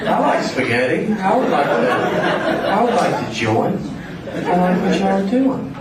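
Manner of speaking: high, timid Southern voice joining the mafia; a high, meek Southerner asking the mob; meek Southern boy. Retold or imitated. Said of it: "go to the mafia and be like".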